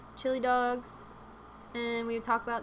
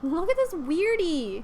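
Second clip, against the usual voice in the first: mock teasing; 5 semitones higher, 5 dB louder, slower than usual. Mock voice at a viewer.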